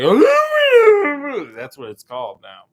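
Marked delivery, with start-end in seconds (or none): 0.0-1.5 high, loud, drawn-out caveman whoop; overexcited mock shriek. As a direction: shriek it out like you're on acid. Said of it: "they're just so excited and on".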